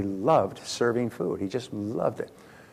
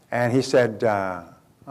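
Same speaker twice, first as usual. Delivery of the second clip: ordinary talk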